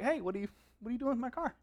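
a fast, hushed mock challenge; a light imitation of a startled car owner; mock heated. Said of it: "this would be a heated conversation".

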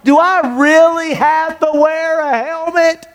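put-on high, pleading whine of a kid; whiny teenage kid. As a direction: beg Dad like a fourteen-year-old who thinks the rule is silly. Kid whining about wearing helmet.

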